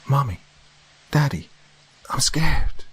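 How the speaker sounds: put-on high, small frightened child's voice; a child calling from the dark, frightened; scared child. A narrator's reading. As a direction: call out like a lost scared little girl. Child's voice heard again.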